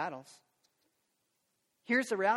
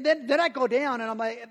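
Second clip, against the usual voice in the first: usual speaking voice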